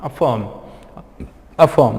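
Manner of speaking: अफर्म and Affirm as "a-fuhm", no R; silent R. Shown as a demonstration. Teacher repeats the right reading for learners, silent R. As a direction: say each without any र. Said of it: "र को हम लोग pronounce नहीं करते".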